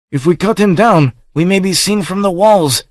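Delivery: high, anxious nomad voice; objecting nomad. A narrator's reading. Nomad warns the chief they may be seen, objecting. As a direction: raise the worry above the chief. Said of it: "objected one of the nomads".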